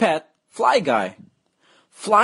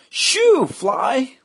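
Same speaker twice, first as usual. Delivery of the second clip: shooing shout; 11 semitones higher than usual. Acted. Boy chasing fly away.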